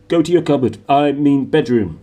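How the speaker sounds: a hint of a breathless, flustered uncle; wheezing uncle. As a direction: gasp it out, correcting yourself mid-breath. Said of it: "he wheezed at Harry".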